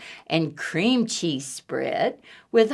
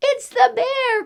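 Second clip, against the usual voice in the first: frightened cry. Acted. Eddie spotting the bear, high frightened cry. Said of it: "cried Eddie".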